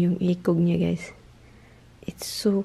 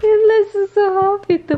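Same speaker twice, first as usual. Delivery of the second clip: much higher, louder delighted pet talk; high cooing; 12 semitones higher, 5 dB louder than usual. Real, not acted. Owner gushing to the pet, high and delighted. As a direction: gush over the pet happily.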